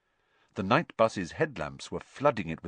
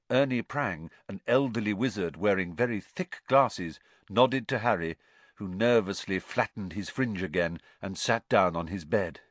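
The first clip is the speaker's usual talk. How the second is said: ordinary delivery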